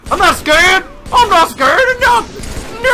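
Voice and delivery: very high, loud shrieking denial; frantic high-pitched yelling; panicked squeal. Real, not acted. Panicking while denying fear.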